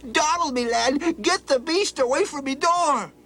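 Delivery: put-on high panicked cry of an old man; frightened scream. Old man screams at Donald from the window, high and panicked. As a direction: shriek it from upstairs in fright. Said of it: "screamed out the window".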